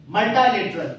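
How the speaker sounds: ordinary delivery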